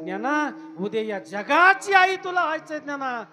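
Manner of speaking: rising to a high, loud wail; anguished cry. Acted. Mother leaving her son, building to a loud anguished cry.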